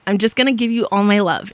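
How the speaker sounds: usual speaking voice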